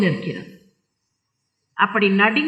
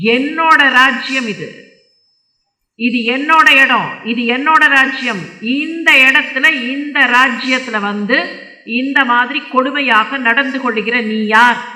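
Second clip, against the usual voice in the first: angry king; 2 semitones higher, 3 dB louder than usual. Acted. King confronts the man beating cow and bull, angry. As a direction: challenge the offender as an indignant king. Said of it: "கோபத்தோட கேட்கறான்".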